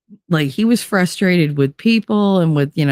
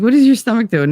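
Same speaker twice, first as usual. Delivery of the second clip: higher, louder, alarmed; alarmed question; 6 semitones higher, 4 dB louder, faster than usual. Retold or imitated. Friend stares at the pregnant belly, higher and louder in alarm. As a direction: demand an answer, higher and louder.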